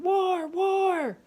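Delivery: high, loud cry of alarm; panicked yelling. A narrator's reading. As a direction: yell a warning while fleeing.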